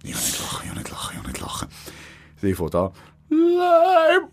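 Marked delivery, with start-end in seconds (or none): 0.0-1.7 breathy whisper, holding in laughter; stifled giggles. Retold or imitated. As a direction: whisper it, trying not to crack up. Wife fighting back laughter. (flüsternd, ein Lachen unterdrückend)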